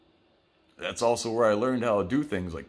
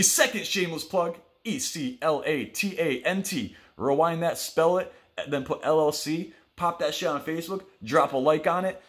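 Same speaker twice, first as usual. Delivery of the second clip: hyped ad plug; 6 semitones higher than usual. Acted. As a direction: sell it like a cheesy ad read. Shameless plug for candle company.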